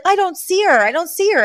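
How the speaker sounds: high-pitched imitated complaint; Fran. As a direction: pitch it up, mimicking a woman searching. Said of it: "and she's like".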